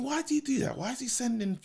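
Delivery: high, hushed wondering to oneself; puzzled inner voice. Retold or imitated. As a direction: voice a baffled private thought, light and high. Voices own puzzled thought.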